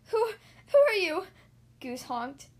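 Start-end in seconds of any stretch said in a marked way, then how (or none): 0.1-1.3 gasp, then high, loud stuttered question; frightened stammer. A narrator's reading. Goose challenges the approaching ghost, frightened stammer. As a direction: blurt it out in alarm.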